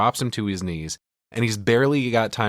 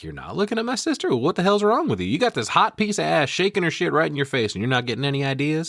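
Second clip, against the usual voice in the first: higher, jeering mockery; taunting; 8 semitones higher than usual. Otis taunting Roy about staring at his sister, jeering. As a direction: needle him with mocking disbelief. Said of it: "kinda taunting him here".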